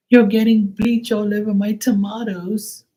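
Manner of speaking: high, whiny mock woman; shrill-sounding whine mimicking the tomato lady; mocking the neighbor. Retelling the neighbor's complaint, in a high whiny mock voice. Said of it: "and she was like".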